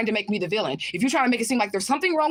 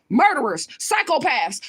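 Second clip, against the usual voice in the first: ordinary delivery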